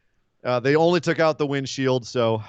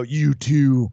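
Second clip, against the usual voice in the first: very slow menacing threat; slow villain threat into the lens; 3 dB louder, much slower than usual. Retold or imitated. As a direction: drag out each word to the camera. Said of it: "Look in the camera and go".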